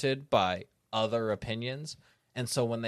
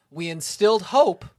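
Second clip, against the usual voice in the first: rousing slogan; 6 semitones higher, 7 dB louder than usual. Pretend.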